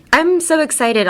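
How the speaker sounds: high, over-excited demo line; too much enthusiasm. Shown as a demonstration. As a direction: gush it, pitched well up. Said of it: "also has too much enthusiasm".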